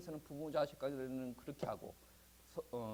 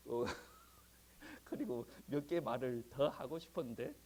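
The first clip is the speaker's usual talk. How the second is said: ordinary talk, nothing marked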